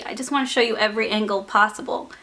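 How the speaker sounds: ordinary talk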